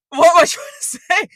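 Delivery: high, very loud yelp after a laugh; exasperated cry. Real, not acted. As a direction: burst out, baffled by your own rambling. Loses her thread, laughing at herself.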